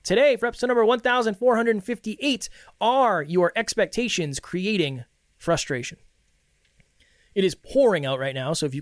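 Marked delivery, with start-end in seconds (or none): 0.0-6.0 podcast host welcome. Acted. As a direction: greet the listeners like a crowd. Host announces episode title.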